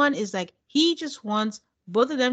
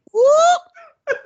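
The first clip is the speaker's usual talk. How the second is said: high, loud gleeful whoop; excited whoop; 11 dB louder than usual. Retold or imitated. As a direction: cheer it out like a fan. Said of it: "And I was like".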